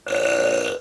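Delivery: real laugh through a voice prosthesis, airy and forced; a hard-won, raspy attempt at laughing; strained laugh. Real, not acted. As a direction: push out the best laugh you can manage. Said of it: "That's my best attempt at laughing".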